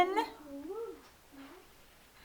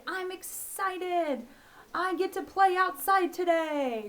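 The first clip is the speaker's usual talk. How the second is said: bright, high-pitched excited voice, a bit higher; 7 semitones higher, slower than usual. Shown as a demonstration. Teacher showing kids an excited sentence, excited. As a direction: sound thrilled to play outside. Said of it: "So you're excited".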